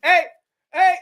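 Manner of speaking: high, playful mock impression calling out; Donald Trump. Mocking a paper-towel giveaway to a crowd, hammed-up Trump. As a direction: call to people as if tossing them something. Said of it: "Donald Trump was like this".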